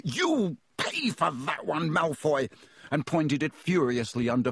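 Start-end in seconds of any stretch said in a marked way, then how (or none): 0.0-2.5 high, drawn-out angry threat; raised voice of an enraged boy; furious yell. A narrator's reading. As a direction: yell a threat, stretching the words.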